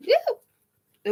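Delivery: high surprised woo; excited whoop. Real, not acted. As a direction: let out a sudden high whoop. Card jumps out of deck.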